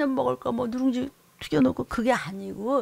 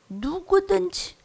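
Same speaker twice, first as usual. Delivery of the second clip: drawn-out repeat; 9 semitones higher, 3 dB louder, much slower than usual. Acted.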